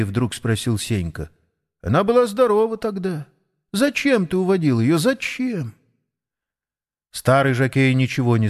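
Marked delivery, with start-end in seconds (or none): 1.8-5.7 put-on high, insistent child's questioning; reproachful boy. Grandson challenges grandfather about the sick horse, high and reproachful. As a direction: press him with pained, higher-pitched accusation.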